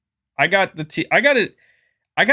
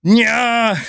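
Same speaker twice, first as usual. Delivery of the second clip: Arnold scream; 9 semitones higher than usual. Pretend. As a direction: belt it out like an over-the-top Arnold. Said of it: "I'm just gonna do an Arnold scream".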